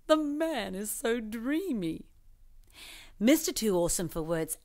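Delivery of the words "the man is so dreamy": slow, dreamy swoon. Acted. Judge swooning over the new hero, slow and dreamy. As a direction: sigh it out like a smitten fan. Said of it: "The judge swooned".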